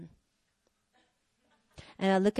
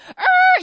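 high, loud screeching noise; imitated tire screech; 11 dB louder than usual. Wife hearing her husband start driving, imitated screech. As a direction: screech like tires peeling out.

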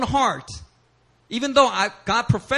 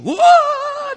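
high, loud whooping cry; mock wail; 9 dB louder than usual. Congregant struck by prayer, high loud cry. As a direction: burst into a high, startled wail. Acting out people's reactions to prayer.

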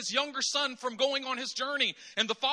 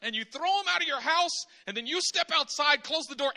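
normal talking voice

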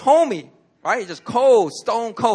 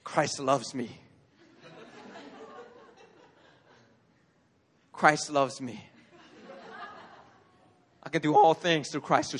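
calm self-reminder; 4 semitones lower, 5 dB quieter than usual. Real, not acted. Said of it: "I have to think".

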